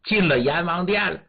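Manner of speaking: plain reading voice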